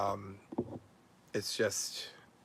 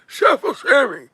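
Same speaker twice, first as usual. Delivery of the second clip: slow, much higher mocking drag on Chef Sammy; mocking squeal; 11 semitones higher, 6 dB louder, slower than usual. Host sneering at the chef's name, slow high mock. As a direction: stretch the name out high and scornful.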